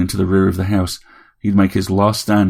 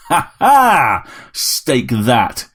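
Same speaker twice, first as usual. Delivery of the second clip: high, loud gloating laugh into a cry; triumphant yell; 4 semitones higher, 4 dB louder, much slower than usual. A narrator's reading. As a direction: crow over a beaten enemy. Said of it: "he yelled".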